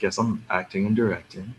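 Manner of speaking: unenthused star. Retold or imitated. LeVar Burton answering an over-excited fan, unenthused. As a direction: give the answer with no excitement, quietly. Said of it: "he said everything like that".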